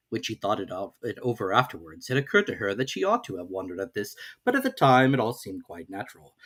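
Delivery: plain reading voice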